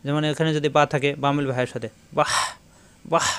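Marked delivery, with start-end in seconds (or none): 2.2-3.3 "বাহ" closing on a long airy rasp; pressed throat h. Shown as a demonstration. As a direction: squeeze the final h of "বাহ" in the throat. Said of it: "কণ্ঠনালীতে চাপ দিয়ে উচ্চারণ হবে".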